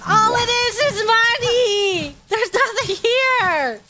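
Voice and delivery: disappointed wail. Real, not acted.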